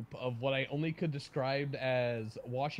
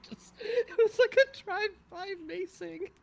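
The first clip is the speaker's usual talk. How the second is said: high, helpless laughter through the words; laughing while talking; 6 dB louder than usual. Real, not acted. Telling friends an absurd macing story, cracking up laughing. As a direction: struggle to get the line out through laughter.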